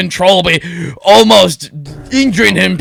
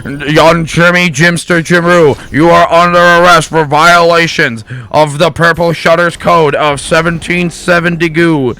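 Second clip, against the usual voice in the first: hammed-up megaphone cop; official arrest; 5 dB louder than usual. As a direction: read the charges like a soldier. National Guard arrest announcement.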